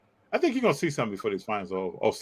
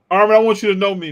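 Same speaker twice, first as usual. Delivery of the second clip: emphatic shout; 8 semitones higher, 10 dB louder, faster than usual. Real, not acted. Insisting loudly to co-host.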